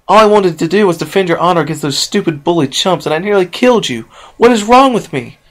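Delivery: high, loud, tearful outburst; tearful self-blame. Acted. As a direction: rail at herself through tears. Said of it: "Tears streamed down her face".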